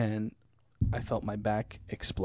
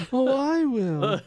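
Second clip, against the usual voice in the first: high slow quote; 13 semitones higher, 4 dB louder, much slower than usual. Retold or imitated. Movie quote.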